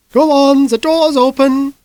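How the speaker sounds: loud, high, slow command to go inside; Mrs Lysander urging. Acted. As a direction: herd him through the door, high and insistent.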